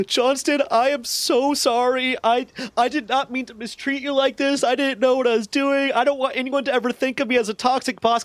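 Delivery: hammed-up high-pitched tearful apology; mock weeping. Sergeant apologizes to the airman she mistreated, mock weeping. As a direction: plead in a squeaky imitation of sobbing. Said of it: "fucking weeping".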